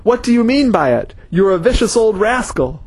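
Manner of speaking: angry man yelling about his crushed foot. Acted. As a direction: shout it up high in outrage. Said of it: "the man was yelling at Wayne".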